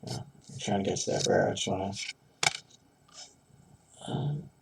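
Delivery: normal talking voice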